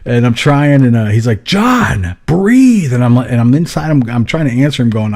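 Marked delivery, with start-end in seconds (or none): 1.4-2.9 high, urgent retold shout; two raised, insistent command words; imitated yelling. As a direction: yell it like an alarmed anesthesiologist. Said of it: "he's, like, yelling at me".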